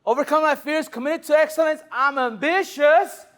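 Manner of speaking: high, loud rally voice; pumped up. Shown as a demonstration. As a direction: fire up the room. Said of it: "pumped sales rally".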